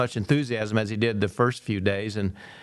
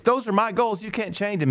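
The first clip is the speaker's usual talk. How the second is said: higher-pitched mock indignant protest; retold furious protest; 10 semitones higher than usual. Athlete protesting to his coach, mock furious and much higher. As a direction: play the kid pushing back, hurt and hot. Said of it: "the kid is furious".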